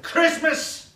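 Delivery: loud high roar of the word; villain shout. Retold or imitated. King of the Universe storming in on the aliens, with a roaring shout. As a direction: bellow it like a monster king. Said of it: "he came in and he went".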